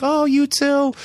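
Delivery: very high imitated old-lady voice; gushing old lady. Old ladies saying goodbye to new friend, high gushing imitation. Ladies returning a compliment at parting.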